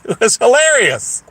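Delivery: usual speaking voice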